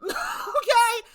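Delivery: high laughing okay; cackling okay, loud and high. Real, not acted. Laughing after insult.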